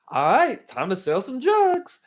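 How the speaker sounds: loud, high gung-ho holler; spring up yelling, ready for business; hammed-up excited shout; eager wake-up cheer. Acts waking up raring to go.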